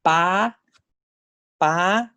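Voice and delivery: "ba" pitched high; "ba" in high Thai third tone; Thai tone three. Shown as a demonstration. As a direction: say "ba" high then let it fall. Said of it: "This thing is pronounced as".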